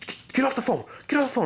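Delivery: high-pitched mock yell; angry dad. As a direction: imitate the dad snapping, pitch way up. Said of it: "He's like".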